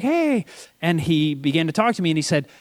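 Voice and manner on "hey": cheerful hey. Retold or imitated. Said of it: "He's like".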